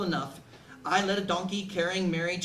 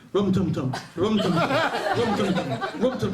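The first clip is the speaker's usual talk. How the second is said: skipping record. Retold or imitated.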